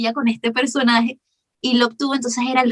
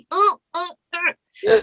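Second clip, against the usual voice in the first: higher, throaty raspy grunts; a scratchy alien villain rasp; raspy throat voice; 8 semitones higher, much slower than usual. Shown as a demonstration. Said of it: "más carrasposo de garganta".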